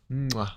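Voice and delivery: loud smacking air kiss; goodnight kiss. Pretend. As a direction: blow a kiss at the mic.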